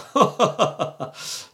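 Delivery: high mock evil cackle; evil villain laugh. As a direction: cackle like a film villain stroking his cat. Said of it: "sortir un rire de méchant genre". (rire de méchant diabolique)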